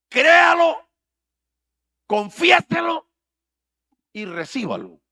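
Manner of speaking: high, loud shouted slogan; mocking preacher slogan. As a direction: belt the catchphrase like a showman preacher. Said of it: "ellos tienen una expresión". (eslogan gritado en burla de predicador)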